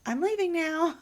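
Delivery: high, loud mimicked announcement; cornered man. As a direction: imitate a man blurting his exit. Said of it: "like a deer in the headlights".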